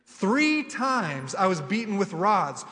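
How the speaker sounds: preaching Paul's sufferings to the church, building; preaching scripture. Acted. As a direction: hammer each hardship home. Reads Paul's list with growing force.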